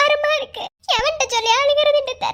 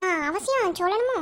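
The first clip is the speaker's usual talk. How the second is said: much lower and softer, coy; shy hesitant murmur; 10 semitones lower, 7 dB quieter than usual. Acted. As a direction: shrink back shyly. வெட்கத்தில் தயங்குகிறாள். (வெட்கத்துடன் மெல்லிய தயக்கம்)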